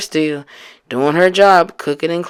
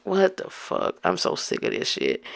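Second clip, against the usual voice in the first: a much softer, near whispered fed-up curse; under her breath; 4 semitones higher, 10 dB quieter than usual. Retold or imitated. Girlfriend cursing to herself about her boyfriend, softly and fed up. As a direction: vent it silently so he can't hear.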